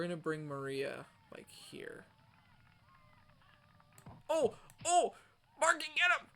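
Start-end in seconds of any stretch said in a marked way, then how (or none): 4.3-6.3 high, loud triumphant yells; excited yelling. Real, not acted. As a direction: erupt in celebration. Big defensive play celebration.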